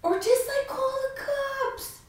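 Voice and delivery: high, drawn-out plea; exasperated suggestion. Acted. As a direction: stretch it out way up high. Exasperated advice to the movie heroine.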